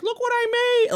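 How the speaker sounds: hammed-up proud look what I made; mock proud boast. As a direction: play a smug creator showing off. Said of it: "be like".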